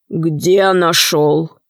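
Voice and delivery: low, very slow threat; stern hero's low, drawn-out question; menacing. A narrator's reading. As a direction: demand it slowly and menacingly. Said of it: "спросил богатырь грозно".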